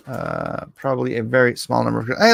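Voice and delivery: normal talking voice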